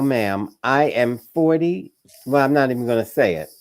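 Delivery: normal talking voice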